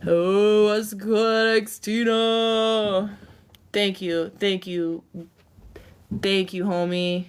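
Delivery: ordinary talk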